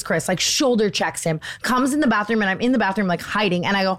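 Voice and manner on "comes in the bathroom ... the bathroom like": ordinary talk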